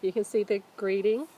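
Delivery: plain reading voice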